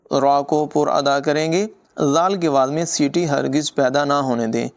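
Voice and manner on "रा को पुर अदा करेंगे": ordinary delivery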